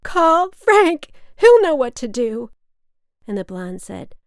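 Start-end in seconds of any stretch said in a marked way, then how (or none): 0.0-2.5 high, very loud desperate yell; full-voiced drunken shriek; scream. A narrator's reading. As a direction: scream it from down the stairs. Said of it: "she screamed again".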